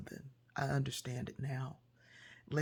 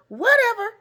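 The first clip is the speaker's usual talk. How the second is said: high, loud brush-off; sharp dismissive toss-off, much higher and louder; dismissive whatever; 17 dB louder than usual. Retold or imitated. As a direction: wave it off like Nan Nan. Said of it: "whatever was her word".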